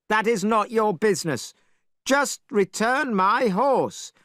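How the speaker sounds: Monkey facing the dragon; Monkey demanding. Acted. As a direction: insist hard, no patience.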